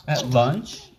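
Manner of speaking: usual speaking voice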